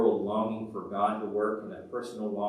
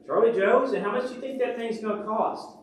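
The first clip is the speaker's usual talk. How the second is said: eager, high-pitched shout to a friend; farmer calling out; 11 semitones higher, 5 dB louder than usual. Acted. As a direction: call over to him, high and loud. Voices farmer calling to Charlie Joe.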